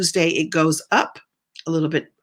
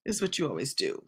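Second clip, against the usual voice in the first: much softer muttered repeat; quiet mutter; 5 semitones lower, 12 dB quieter, faster than usual. Real, not acted. Host mutters the complaint again to herself, much quieter. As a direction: mutter it under your breath.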